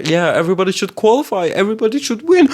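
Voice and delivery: high, fast mock voice; mock cheerful fan. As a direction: squeal it like a naive fan. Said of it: "be like".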